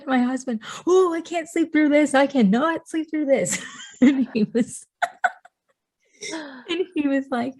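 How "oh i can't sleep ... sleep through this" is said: distressed retelling. Retold or imitated. Telling husband she can't sleep through contractions, high-pitched distress. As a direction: reenact overwhelmed pleading, voice pitched way up.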